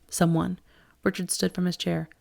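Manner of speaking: ordinary delivery, nothing marked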